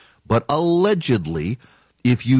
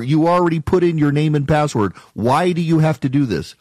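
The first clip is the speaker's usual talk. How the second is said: usual speaking voice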